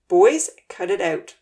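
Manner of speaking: loud, slow, stern command. A narrator's reading. Uncle Louie stopping the boys' shoving match, stern and loud. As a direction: break up the fight firmly. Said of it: "Uncle Louie said sternly".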